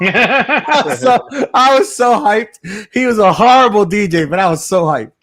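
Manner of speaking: high, loud, excited retelling; hyped-up excitement. Real, not acted. Host telling friends about a costumed DJ, hyped-up excitement. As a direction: gush loudly, stretching out the opening words.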